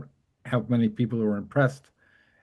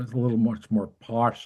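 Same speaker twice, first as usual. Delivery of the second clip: ordinary delivery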